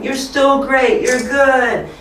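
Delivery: high, slow upbeat praise; encouraging. Pretend. Teacher to kids who lose, high encouraging praise. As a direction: lift them up with high, drawn-out praise. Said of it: "be encouraging still to them".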